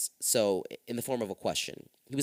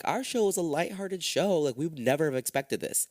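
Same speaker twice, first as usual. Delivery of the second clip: raised, breezy TV host voice; high talk-show host voice; 7 semitones higher than usual. Retold or imitated. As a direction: play the host brushing it off lightly. Jenny's defence quoted in court.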